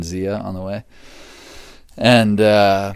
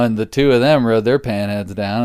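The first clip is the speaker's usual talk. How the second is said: ordinary talk, nothing marked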